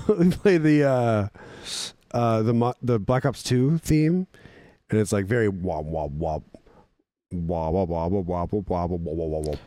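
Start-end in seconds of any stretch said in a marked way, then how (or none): 5.3-9.6 low droning wop wop wop; wop wop noise. Retold or imitated. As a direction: imitate a deep wobbling bass. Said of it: "it's like a wubby wub sound".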